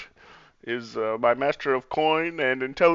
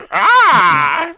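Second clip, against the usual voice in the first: put-on old man exclamation; 8 semitones higher, 12 dB louder than usual. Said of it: "If they go".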